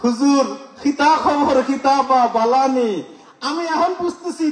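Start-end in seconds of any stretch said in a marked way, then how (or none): 0.0-3.0 Sylheti dialect. Retold or imitated. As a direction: draw out the Sylheti words slowly. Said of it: "সিলেটের ভাষার কথা".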